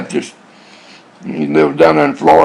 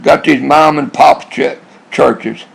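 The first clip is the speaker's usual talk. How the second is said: ordinary delivery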